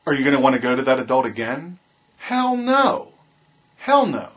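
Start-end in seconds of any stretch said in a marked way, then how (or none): 2.2-4.3 emphatic refusal. Real, not acted. Emphatic refusal to rhetorical question.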